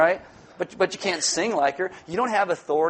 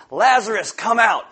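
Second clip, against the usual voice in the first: loud, raised call of authority; commanding; 4 semitones higher, 6 dB louder than usual. Acted. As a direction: summon him as Jesus would. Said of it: "muster up all the authority".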